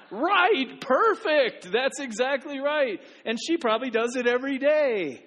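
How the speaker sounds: loud, high, delighted burst after laughing. Real, not acted. Pastor thrilled by a little girl's answer, shouting with delight.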